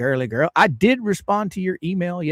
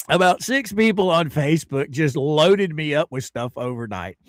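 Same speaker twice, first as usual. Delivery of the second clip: normal talking voice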